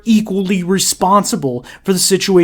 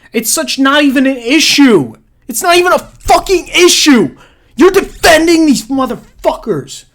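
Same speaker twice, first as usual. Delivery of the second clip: high, loud, enraged yell; shouting in disbelief at the pundits; furious rant; 10 semitones higher, 5 dB louder than usual. Real, not acted. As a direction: blow up at them, voice cracking high. Ranting at the TV pundits.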